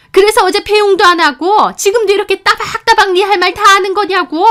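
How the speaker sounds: high-pitched, loud screaming rant; furious shouting. A narrator's reading. Mother-in-law accusing her daughter-in-law of looking down on her, furious shouting. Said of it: "왜 저한테 이렇게 소리 지르고 화내세요".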